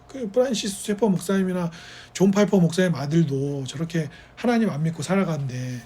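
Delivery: normal talking voice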